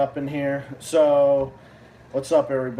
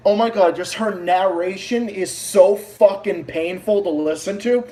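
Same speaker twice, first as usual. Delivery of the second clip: usual speaking voice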